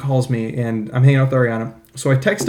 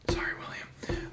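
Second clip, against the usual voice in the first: hushed apology whisper; 18 dB quieter than usual. Real, not acted. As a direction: whisper so nobody wakes. Landlord sleeping, apologizing.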